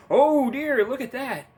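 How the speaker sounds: high, loud fake "oh dear"; mock shock. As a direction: act falsely startled by the news.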